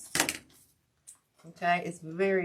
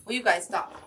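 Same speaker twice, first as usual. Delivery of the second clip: yelling at dogs; 2 semitones higher, 9 dB louder than usual. Real, not acted.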